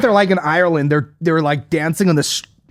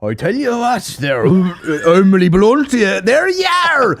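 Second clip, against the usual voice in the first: hammed-up stage Irish, climbing high; high, drawn-out Irish caricature; mock Irish accent; 6 semitones higher, slower than usual. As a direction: parody a bad film Irish accent, stretching words. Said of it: "the actual rundown on the accent".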